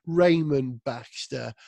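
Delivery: ordinary delivery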